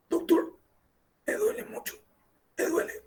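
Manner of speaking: imitating a frightened patient in chest pain. Patient in chest pain begging the doctor, faint strained plea. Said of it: "Empieza a hablar".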